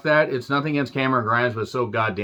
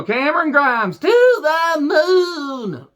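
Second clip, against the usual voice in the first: much higher, loud, slow West Virginia whoop; West Virginia accent; 14 semitones higher, 4 dB louder, much slower than usual. Retold or imitated. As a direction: howl it out in a cracking West Virginia holler. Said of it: "do that accent so goddamn well".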